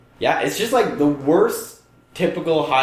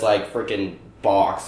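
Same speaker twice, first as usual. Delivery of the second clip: normal talking voice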